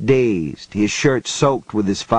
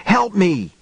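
shouted plea; 8 semitones higher than usual. A narrator's reading. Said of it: "Johnny shouted".

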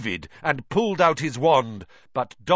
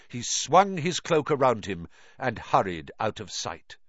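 plain reading voice

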